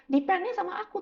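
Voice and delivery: a hint of God insisting; God's voice. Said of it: "Tuhan bilang".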